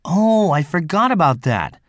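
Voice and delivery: ordinary delivery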